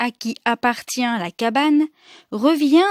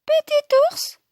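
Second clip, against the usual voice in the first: much higher, tiny-sized name. A narrator's reading. Storyteller introducing Baby Bear, tiny high voice. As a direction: shrink the name into a tiny squeak.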